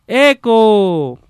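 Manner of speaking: high, drawn-out distant call; echo. Acted. Voice answering from the other side, echoing call. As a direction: stretch it out like an echo, falling at the end. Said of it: "el eco es todo".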